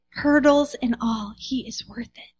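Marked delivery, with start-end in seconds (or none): 1.4-2.3 softer whisper of worth. Real, not acted. Preacher closing on Jesus, soft whisper. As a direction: breathe it out in a whisper.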